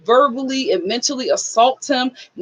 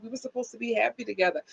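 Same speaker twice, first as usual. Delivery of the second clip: hushed, quick, mock sob; soft tearful imitation; 12 dB quieter, faster than usual. Dylan crying in the Uber, lightly imitated. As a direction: mimic him crying softly. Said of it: "He just starts bawling".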